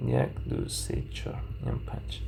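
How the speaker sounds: counting to five in a whisper; murmured count while drawing. Real, not acted. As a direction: breathe the numbers out under your breath. Counting off parts under breath.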